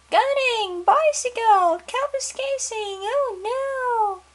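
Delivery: high, loud panicked wail; panicked father. Acted. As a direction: wail each word in rising alarm. Father panics about daughter.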